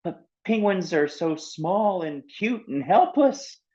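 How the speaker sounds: put-on high, slow pleading girl voice; pleading reason. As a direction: coax him gently to see it. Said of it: "She tried reasoning with Seal".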